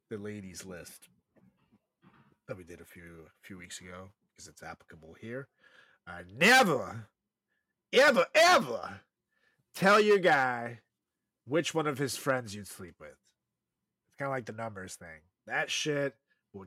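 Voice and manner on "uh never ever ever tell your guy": never, ever, ever hit high and loud, slowly; stress on never. Real, not acted. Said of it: "emphatic advice".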